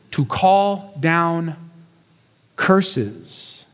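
slow, with long pauses between words; weighty, drawn-out retelling; slow dramatic emphasis. Shown as a demonstration.

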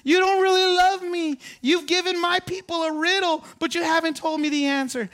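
high, whiny mock sobbing plea; hammed-up tearful wife voice; mock crying wife. Wife pleading with Samson, mock tearful and high. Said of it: "and she's crying".

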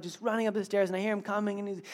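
ordinary delivery

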